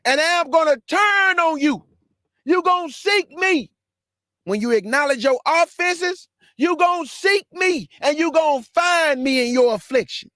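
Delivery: full-force preaching. Acted. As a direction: drive it home to the congregation. Preacher voices God turning away.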